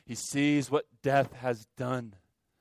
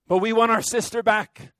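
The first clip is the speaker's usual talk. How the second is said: loud, higher plea pressing on want; pleading cry; 9 semitones higher, 8 dB louder than usual. Acted. Preacher voicing the congregation's prayer, loud pleading cry. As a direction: cry out for the sister back. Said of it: "we pray".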